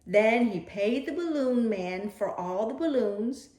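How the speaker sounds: usual speaking voice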